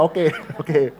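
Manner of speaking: chuckling through the words; real amused chuckle; laughing okay. Real, not acted. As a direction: laugh it off as you concede. Congregation joke about Christ.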